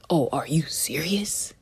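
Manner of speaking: a mock whispered disbelief; hushed, incredulous aside; imitating Biden. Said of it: "he was just like".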